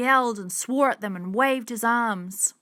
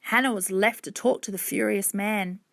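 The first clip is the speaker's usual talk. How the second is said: plain reading voice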